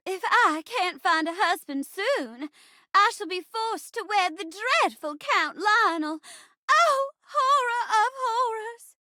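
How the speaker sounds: high-flown, distressed stage heroine; melodramatic debutante. Acted. Debutante fearing a forced wedding, melodramatic dismay. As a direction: dread the match, rising to a high wail. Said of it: "a debutante trying to marry".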